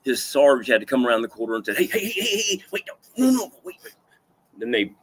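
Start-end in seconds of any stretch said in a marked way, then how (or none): 1.8-3.9 much higher, urgent hey hey wait; alarmed sergeant. Retold or imitated. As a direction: rush in to stop the guard hurting him. Sarge stopping the guard.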